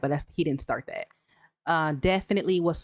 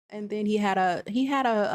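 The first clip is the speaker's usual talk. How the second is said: normal talking voice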